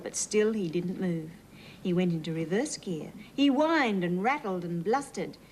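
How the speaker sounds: usual speaking voice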